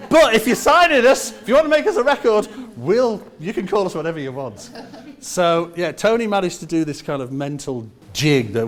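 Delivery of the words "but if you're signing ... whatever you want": high, laughing squeal into the words. Real, not acted. Band agreeing to be pop, laughing. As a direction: give in while laughing.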